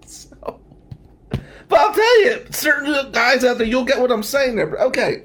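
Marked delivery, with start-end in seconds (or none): 1.7-5.2 high, loud punchline to the crowd; a playful yelled aside. Real, not acted. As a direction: shout it to the room. Comic aside to the audience.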